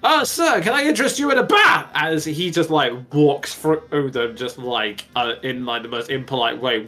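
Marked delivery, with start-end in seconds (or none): 0.0-1.9 high, loud fawning hawker voice; eager merchants. Acted. GM voicing townsfolk hawking baths, high fawning squeal. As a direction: squeal it like a pushy bath seller. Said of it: "people are coming up to him going".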